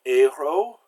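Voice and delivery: héros with the final s left silent; silent final consonant. Shown as a demonstration. Teacher showing silent endings, héros with no s sound. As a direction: say héros in French, ending on the o vowel. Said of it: "final consonants are usually silent".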